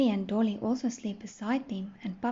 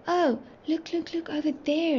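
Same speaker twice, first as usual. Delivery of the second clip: higher-pitched storybook exclamation; mild excited shout; 6 semitones higher than usual. A narrator's reading. Dolly spots fairyland below, higher mild shout. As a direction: point it out eagerly, without real volume. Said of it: "Dolly shouted".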